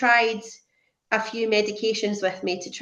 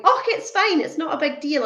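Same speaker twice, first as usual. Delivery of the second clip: high-pitched, airy dismissal; imitating her own breezy brush-off; acted blasé; 7 semitones higher than usual. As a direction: wave it away in a lifted voice. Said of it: "I was being so blasé about it".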